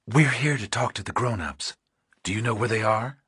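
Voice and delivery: a hint of a calm woman investigator; Pauling's voice. A narrator's reading. Pauling asking a child where the adults are, lightly voiced woman.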